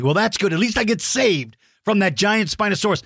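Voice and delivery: normal talking voice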